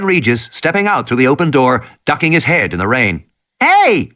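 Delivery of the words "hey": put-on girl calling out. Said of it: "Lex said".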